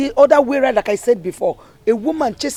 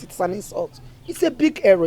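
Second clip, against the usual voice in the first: normal talking voice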